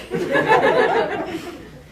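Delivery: airy laughing burst; breathy laugh. Real, not acted. Laughing at memory.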